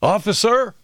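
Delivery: high-pitched call across a highway; retold yell. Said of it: "He yells out to me".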